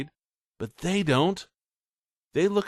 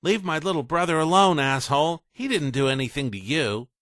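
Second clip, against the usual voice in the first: higher, louder warning; protective anger; 7 semitones higher, 4 dB louder than usual. Acted. Ray defending little brother from Coker, angry. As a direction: stand between them and warn.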